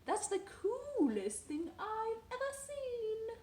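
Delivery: high, loud, slow gasp of delight; a mother amazed by her toddler's tower; exclaiming mother. A narrator's reading. Mother amazed by the tower, exclaiming loudly. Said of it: "his mother exclaimed".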